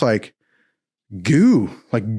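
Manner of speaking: ordinary talk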